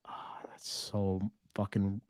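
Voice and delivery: whispered in mock outrage, slow; mock offended whisper. Real, not acted. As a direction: breathe it, pretending to be hurt.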